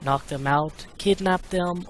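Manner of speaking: usual speaking voice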